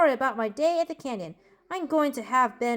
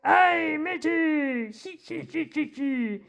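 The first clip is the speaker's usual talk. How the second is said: playful pirate cry with a tittering laugh; 2 semitones higher, slower than usual. Acted. Pirate on his eightieth birthday, jokey pirate voice. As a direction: hail a shipmate, then snicker away. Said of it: "What did the pirate say".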